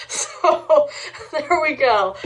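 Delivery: laughing through the words. Real, not acted. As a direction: chuckle it out. Laughs while ranking candy.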